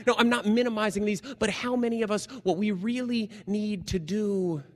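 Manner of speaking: high, fast, loud insistence; rising preacher's plea; emphatic build. Real, not acted. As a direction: push it up with urgency.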